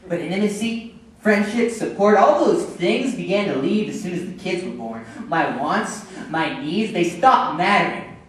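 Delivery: aggrieved husband, voice rising; frustrated outburst. Acted. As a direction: build into a resentful rant.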